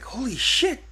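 very high-pitched retold disbelief; high, incredulous squeal without much added volume; astonished exclamation. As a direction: imitate shock, voice shooting way up. Retold shock at finding his cousin.

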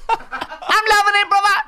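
high, drawn-out mock slogan, laughing; joke advert line. Joke McDonald's audition line.

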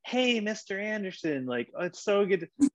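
high, gushing greeting to the boss; over-eager rapport seeking. Acted. Employee greeting the CEO in the kitchen, rapport seeking. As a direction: pitch it way up and fawn over them. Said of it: "like, you know, the boss comes in".

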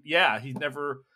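normal talking voice

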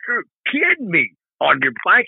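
high mock disbelief from the stranger; stranger's disbelief. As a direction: act out the guy who can't believe it.

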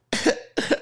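two acted coughs; mock coughing. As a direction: cough as if smoke hit the face. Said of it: "And they both".

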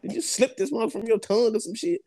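confronting her about the razor blade; incredulous outrage. Retold or imitated. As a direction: demand it high in disbelief. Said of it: "I'm like".

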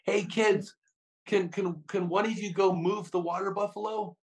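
mock yell to the kids to shift the buffalo; calling out to kids. Jacob yelling to kids on the course, high mock call. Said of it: "So he yells to the kids".

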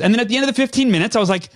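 usual speaking voice

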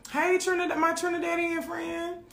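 high-pitched, stretched-out sing-song shout-out; sing-song greeting. Real, not acted. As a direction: greet a friend in a high held chant. Calls out greeting to listener.

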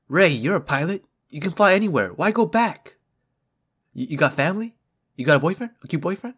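high, loud teasing voice; character impression. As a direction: tease Rey like a nosy pilot. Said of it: "Okay, I got one".

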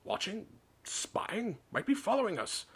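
high, agitated muttering; wild muttering. A narrator's reading. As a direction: think aloud in rising paranoia. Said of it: "muttered Uncle Vernon wildly".

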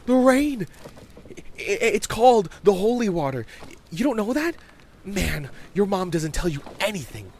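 put-on high, loud, stammering kid; incredulous child, tripping over his words; exasperated young boy. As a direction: explain the obvious to a clueless friend. Voices Bobby, impatient.

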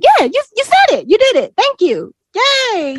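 high, loud praise; mock cheering. Foreigners praise her English, high loud mock cheering. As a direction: cheer like a proud fan.

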